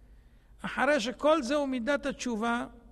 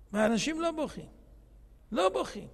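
ordinary talk, nothing marked

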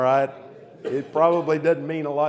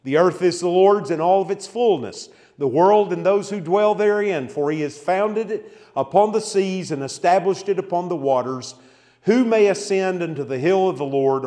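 louder, raised reading of a psalm; solemn scripture reading; 3 semitones higher, 4 dB louder than usual. Acted. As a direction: proclaim the verses to the room. Reads a psalm aloud.

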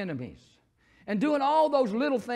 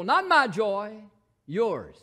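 ordinary talk, nothing marked

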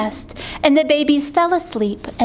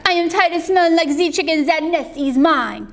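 put-on hurried, higher French-accented voice; duck's French cry; 4 semitones higher, faster than usual. Duck tired of chicken, quick French-accented complaint. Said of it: "cried the duck".

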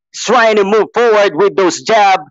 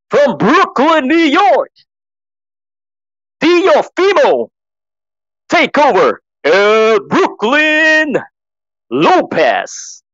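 Mark Chinook; 3 semitones higher than usual. Acted. Introducing the reigning champion from Brooklyn, grand ring-announcer call. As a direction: build to a soaring, stretched-out boxer's name. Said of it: "I'm just copying Mark Chinook".

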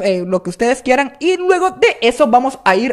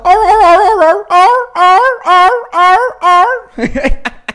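high, loud whimpering dog wails; repeated "oh-oh" howls like a crying dog; 9 semitones higher, 5 dB louder than usual. Shown as a demonstration. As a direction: yowl like a dog crying, breaking into laughter. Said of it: "como que si fuera un perro llorando".

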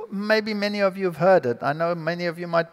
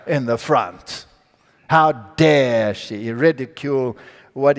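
indignant outrage; 7 semitones lower, slower than usual. Retold or imitated. Professor fuming at the student who defied him, slow and indignant. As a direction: lean into each word with contempt, slowly. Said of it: "this hatred, just boiled up".

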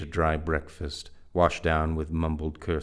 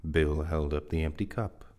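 plain reading voice

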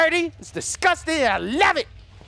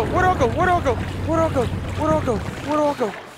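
ordinary talk, nothing marked

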